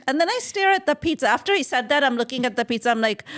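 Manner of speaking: normal talking voice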